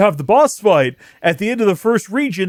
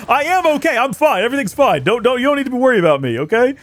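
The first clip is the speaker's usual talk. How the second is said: mock reassurance; 6 semitones higher, faster than usual. Real, not acted. Reassuring after a coughing fit.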